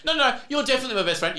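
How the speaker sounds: high, fast, overeager; mock insincere gushing. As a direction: gush it fast with fingers crossed. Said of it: "fingers crossed the whole time".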